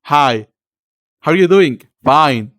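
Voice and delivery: short, over-projected awkward chitchat; awkward small talk. Acted. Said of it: "I'm just like".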